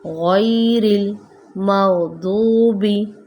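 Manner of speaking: गैरिल slow, the गै held; pause on ghain. Shown as a demonstration. Teacher recites ghairil maghdubi, slow. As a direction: hold the गै a little. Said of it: "हम इस पर थोड़ा सा ठहराव देंगे".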